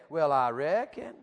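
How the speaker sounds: slow Southern drawl. Shown as a demonstration. Preacher coaching Yankees in the room to join in, Southern drawl. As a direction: lead them in an exaggerated Southern twang, slowly. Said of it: "Say it again".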